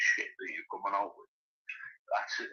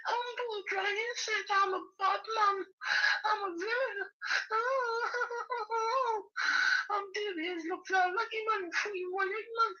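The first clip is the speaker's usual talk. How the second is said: high squeaky mock voice of the rival. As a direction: squeal his boast back at him like a cartoon. Mocking a rival's boast.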